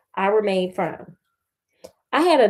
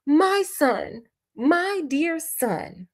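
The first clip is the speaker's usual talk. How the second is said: high-pitched fond exclamation; joyful exclamation; 6 semitones higher, much slower than usual. A narrator's reading. Father greeting his restored son, exclaiming with joy. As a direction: exclaim it high, drawing out the second cry. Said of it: "I exclaimed, kissing him in a transport of joy".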